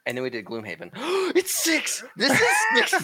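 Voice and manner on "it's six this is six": high, loud excited shout with a laugh. Real, not acted. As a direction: shout it out in disbelief. Realizing count is higher.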